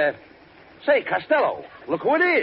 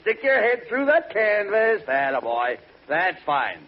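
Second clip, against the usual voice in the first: normal talking voice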